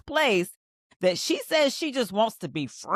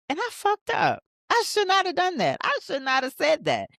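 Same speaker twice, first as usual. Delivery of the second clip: high-pitched self-reproach; voicing guilty regret, pitched high; acted regret; 11 semitones higher than usual. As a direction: kick yourself in a raised, emphatic voice. Acting out someone feeling guilty.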